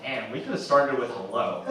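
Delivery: ordinary delivery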